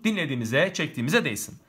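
usual speaking voice

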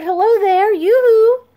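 high, loud sing-song call; hollering. A narrator's reading. Neighbor calling to the man across the yard, high and loud. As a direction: wave and call out eagerly from afar.

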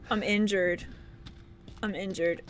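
soft, low, drained voice; spent, subdued. Real, not acted. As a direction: say it quietly after the battle. Drained after the fight.

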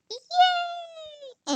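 high, long sung-out yay; high cheer. Real, not acted. Success in game.

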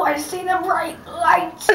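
strained, slow cry of agony; anguished cry. Real, not acted. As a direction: cry out from the sour burn. Overwhelmed by sour candy.

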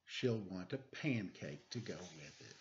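put-on lively read-aloud for children; storybook reading. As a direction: read it like a bedtime picture book. Reads picture book aloud.

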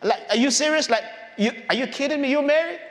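high, disbelieving questions; mock disbelief, rising at the end; acted incredulity. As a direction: question it like it's absurd. Mocking disbelief at Mary's claim.

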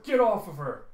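loud, high command; furious rescue shout. Acted. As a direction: shout attackers away from your wife. Shouts at attackers while shooting.

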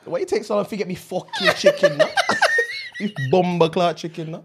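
can barely hold the Jamaican voice for giggling; Jamaican accent laughing. Pretend. Friend ranting about late chicken in a laughing Jamaican accent.

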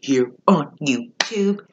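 slow robotic alien greeting; old speech synthesizer. Pretend. Alien greeting opens the vlog.

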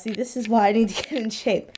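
ordinary delivery

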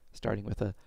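ordinary talk, nothing marked

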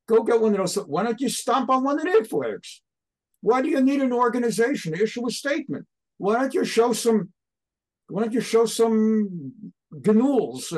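high-pitched, impassioned goading; passionate tirade. Real, not acted. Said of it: "we love your passion and your enthusiasm".

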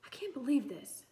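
high, loud, exasperated complaint; sighing disbelief. A narrator's reading. Boy fed up that everyone will write, sighing. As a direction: sigh it out in exasperation. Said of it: "Bastian sighed".

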